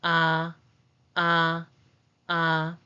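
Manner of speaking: "Ah" held long on a level pitch; separate, drawn-out "ah" for learners to copy; vowel demo. Shown as a demonstration. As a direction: say "ah" as a steady open vowel, evenly repeated. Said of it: "The pronunciation is".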